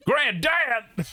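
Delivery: high, loud cry of the word; shouted game title. Pretend.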